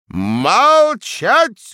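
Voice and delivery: thundering command. A narrator's reading. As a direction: roar for silence. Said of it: "громыхнул преподаватель".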